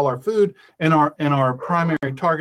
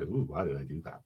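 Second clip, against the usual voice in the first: low, soft, groaning regret; low, soft queasy moan of regret; regretful groan voice; 13 semitones lower, 13 dB quieter than usual. Retold or imitated. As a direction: moan it like a full stomach aching. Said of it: "I say it in that voice too".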